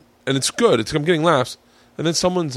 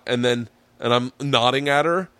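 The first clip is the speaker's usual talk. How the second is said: ordinary delivery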